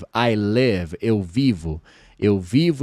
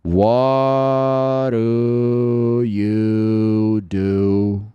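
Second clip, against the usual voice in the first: What do you do for a living drawn out word by word; very slow drill; much slower than usual. Shown as a demonstration. Teacher leading a slow repeat-after-me drill. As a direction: say it very slowly aloud. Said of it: "Fala comigo devagarinho, em voz alta".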